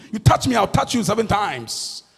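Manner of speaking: ordinary talk, nothing marked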